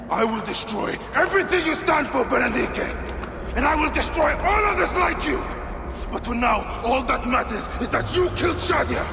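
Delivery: loud vow of revenge, building to a scream; furious shout. Acted. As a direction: rage at her before the kill. Bayek's vow of vengeance.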